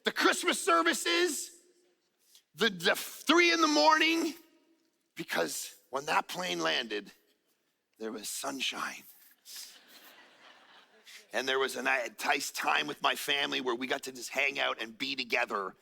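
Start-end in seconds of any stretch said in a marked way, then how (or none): 5.1-15.8 tender. Real, not acted. Pastor telling congregation of family joy, tender. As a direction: let it sink in gently. Sermon point turns soft.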